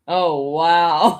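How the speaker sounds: loud, low deadpan wow; sarcastic. Shown as a demonstration. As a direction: sound unimpressed on purpose. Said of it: "You go like this".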